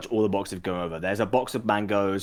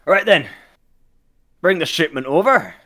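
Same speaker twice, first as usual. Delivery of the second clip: raised, higher voice to the crew; projected command; captain's order; 7 semitones higher, 6 dB louder than usual. Acted. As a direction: call the order out across deck.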